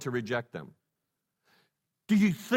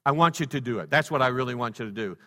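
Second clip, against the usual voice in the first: ordinary talk, nothing marked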